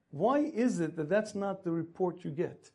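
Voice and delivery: normal talking voice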